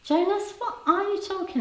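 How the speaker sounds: what on earth do you mean; incredulous. Real, not acted. Host questioning viewer Jonas, incredulous.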